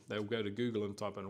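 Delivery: ordinary talk, nothing marked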